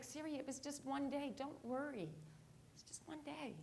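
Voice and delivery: soft, hushed comforting voice; reassuring mother. Retold or imitated. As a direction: soothe gently, as a mom on the phone.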